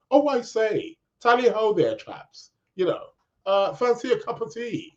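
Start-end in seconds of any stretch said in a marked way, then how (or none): 0.1-2.5 hammed-up posh British accent, pitched higher; playfully imitating posh Queen's English; mock Queen's English. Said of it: "they think we all speak like the Queen's English". 3.7-4.9 hammed-up posh British accent, pitched higher; playfully imitating posh Queen's English; mock Queen's English. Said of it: "they think we all speak like the Queen's English".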